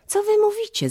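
high-pitched, puzzled exclamation; surprised cry. A narrator's reading. Astonished mother questions her dancing children, high surprised cry. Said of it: "zawołała matka".

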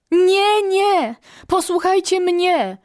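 high, loud insistent call. A narrator's reading. First quail demanding to be heard, calling out over noise. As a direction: shout over a growing din. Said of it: "zawołała znów pierwsza przepiórka".

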